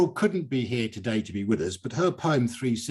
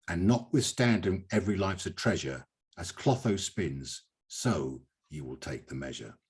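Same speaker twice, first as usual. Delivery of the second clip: poem recital; 5 semitones lower, 5 dB quieter than usual. Acted. Host reading the favourite couplet, softly measured. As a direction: let the couplet settle gently.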